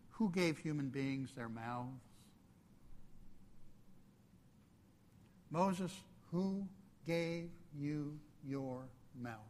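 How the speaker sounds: a hint of God speaking quietly, slow and soft; lightly voiced gentle God, reassuring; quiet compassionate voice. God answering a frightened Moses, in a soft, slow, compassionate voice. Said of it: "I think it was a quiet, soft, compassionate voice".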